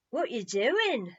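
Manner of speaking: loud, slow blunt question; blunt child. A narrator's reading. Dibbs bluntly asking Peter what he's doing. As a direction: ask it straight out, no manners. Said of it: "blunt as ever".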